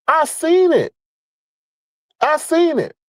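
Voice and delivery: high, urgent repeat; insist, voice rising high; emphatic insistence. Real, not acted. Emphatic repetition, pitch up.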